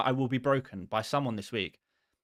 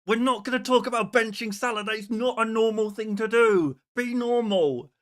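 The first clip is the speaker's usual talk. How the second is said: exasperated scolding; 8 semitones higher, 4 dB louder than usual. Retold or imitated. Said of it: "And I just wanna say".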